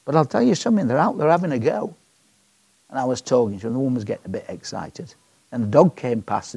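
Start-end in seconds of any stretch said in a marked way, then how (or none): none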